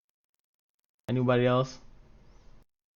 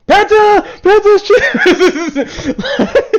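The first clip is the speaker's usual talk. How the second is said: much higher and louder, stretched yell; yelling with laughter at scoring a pentakill; excited shout; 11 dB louder, much slower than usual. Real, not acted. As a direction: scream it out in triumph. Screaming at a pentakill.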